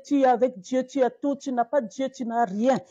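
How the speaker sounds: usual speaking voice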